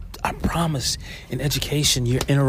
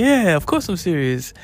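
put-on boyfriend's reply; 7 semitones higher, 4 dB louder than usual.

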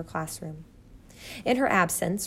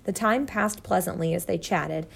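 plain reading voice

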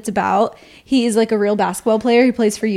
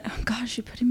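whisper; 12 dB quieter, faster than usual. Real, not acted. Put on the spot.

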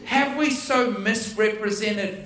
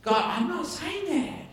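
lightly voiced, quiet protest to God; half-laughing private refusal, hushed; a hint of a sheepish inner voice; inner talk with God; 3 semitones higher, 6 dB quieter than usual. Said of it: "my discussion with God".

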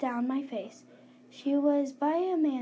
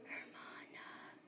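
slow unvoiced whisper; ghostly whisper; 24 dB quieter, slower than usual. A narrator's reading. Ghost calling to the girl in a slow whisper. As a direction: breathe the word slowly like a ghost. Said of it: "she called".

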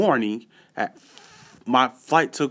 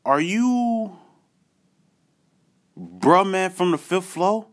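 high, slow, amazed call-out; a fan spotting a star, pitch way up; hyped yo are you him; excited recognition; 7 semitones higher, much slower than usual. Retold or imitated. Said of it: "I'm looking, and I'm like".

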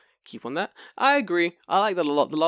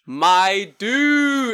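excited; 9 semitones higher, 10 dB louder than usual. Real, not acted. Excited reaction.

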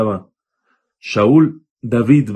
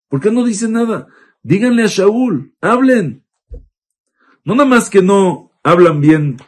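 much higher, pleading and demanding; indignant outcry; 8 semitones higher than usual. Acted. David rebuking Saul's men for their silence, indignant and high. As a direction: demand they finally speak up.

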